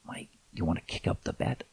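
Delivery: soft whispered offer. Retold or imitated. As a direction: whisper it slyly to Mike. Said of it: "and I said".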